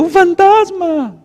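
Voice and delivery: high, slow frightened shriek; mock terrified scream. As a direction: imitate the ghost panic. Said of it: "Pedro hace un rato gritó".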